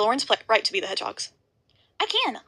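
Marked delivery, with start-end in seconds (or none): 2.0-2.4 very high, squeaky robotic "I can"; high-pitched robotic squeal. A narrator's reading. Lauren showing she can do the hedgehog voice, high robotic squeal. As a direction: squeal it like a spiky little animal. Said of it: "in a high-pitched robotic squeal".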